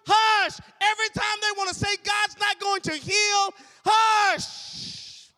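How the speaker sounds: high, loud shouted preaching; preaching at full force. Acted. Preacher urging the congregation to hush doubters, fiery. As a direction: drive each hush home.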